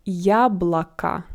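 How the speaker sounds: Яблоко slowly as "YA-bla-ka"; unstressed O as A. Shown as a demonstration. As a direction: stretch Яблоко, both o sounded as "ah". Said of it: "both of them are pronounced like A".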